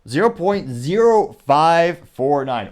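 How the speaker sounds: every digit spelled out slowly and deliberately; slow, exact figure. Shown as a demonstration. Reading the exact like percentage to viewers, slow and deliberate. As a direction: drag out the exact tiny percentage for effect. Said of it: "Or if you feel so inclined, we could round up".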